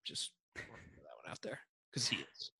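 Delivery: hushed, unvoiced throwaway aside; very soft whisper. Real, not acted. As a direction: slip it in under your breath. Sneaking in an opinion as aside.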